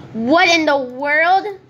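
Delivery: baffled gamer outcry; stunned disbelief. Real, not acted. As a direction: let the shock stretch out. Baffled by the game.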